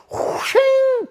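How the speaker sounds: high vocal "shing" of a drawn blade; imitated knife swish. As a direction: make a long, high whoosh of a knife coming out. Imitates knife being pulled out.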